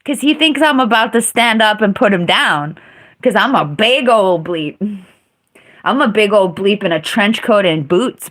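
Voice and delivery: ordinary delivery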